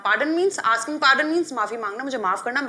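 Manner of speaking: normal talking voice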